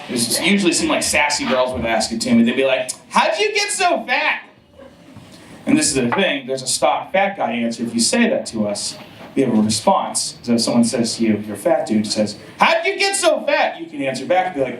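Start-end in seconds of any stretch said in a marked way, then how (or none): 3.1-4.4 high, slow, sassy mock question; squeaky mean-girl jab. Sassy girls mocking fat guy. 12.6-13.8 high sassy mock question; squeaky mean-girl jab. Sassy question quoted again.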